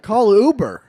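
high, loud, dismissive comeback; acted-out yell; retold shouted retort. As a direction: shout it back across a parking lot. Acts out his yelled retort.

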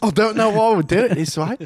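put-on British; British accent. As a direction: pitch up into a fake British accent. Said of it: "I'll be a British guy".

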